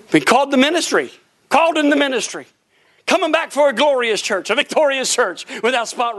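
loud, ringing preacher cadence; preaching fervor. Acted. Preacher rallying the congregation, loud fervent preaching.

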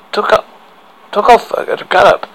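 ordinary delivery, nothing marked

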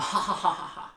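high, loud staged belly laugh; mock cracking up. Joke teller acting the whole jail cracking up, mock laughter. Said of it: "everyone in the whole jail just starts cracking up".

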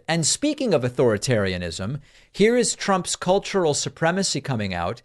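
usual speaking voice

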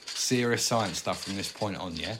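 usual speaking voice

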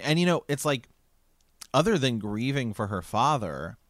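normal talking voice

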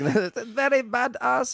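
slow, hammy German accent; a bad Arnold-style German accent. Shown as a demonstration. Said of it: "I was trying to do a German accent".

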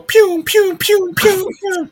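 very high zapping "pune" sounds; laser gun noises. Pretend. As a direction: fire "pune" like a toy blaster. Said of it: "Are you pretending to shoot people?".

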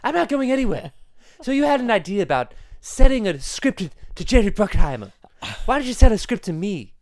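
put-on high, loud, haughty baby voice; Stewie impression. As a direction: refuse to leave, imperious. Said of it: "my friend Stewie".

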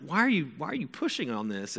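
retold teen at wit's end; exasperated. Son asks mom why she is pushing, exasperated.

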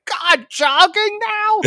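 high, slow, exasperated diva voice; Miss Piggy. Retold or imitated. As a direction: squeal it like a spoiled star. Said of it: "So now she's like".

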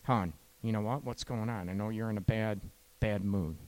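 a hushed, caring partner; tender concern. Shown as a demonstration. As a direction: ask softly, really checking in on her. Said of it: "You wanna hit on their emotions".

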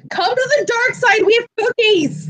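high singsong villainous invitation; playful luring. Acted. Fan luring wrestler to the villains, playful coaxing. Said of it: "I'm sitting here like".

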